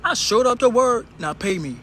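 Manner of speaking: defiant retort. Real, not acted. Joking reply to secretary.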